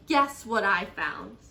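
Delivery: loud, drawn-out announcement; shout. A narrator's reading. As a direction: shout out your news, stretching the words. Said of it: "she shouted".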